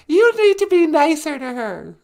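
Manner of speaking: very high, loud little voice; reproachful sidekick. Acted. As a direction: tell him off in a squeaky puppet voice. Sidekick character scolds the host.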